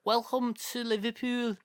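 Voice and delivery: high, exaggerated Liverpool welcome; caricatured Scouse; mock Scouse accent. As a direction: greet in a put-on Scouse voice. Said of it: "they all sound like this".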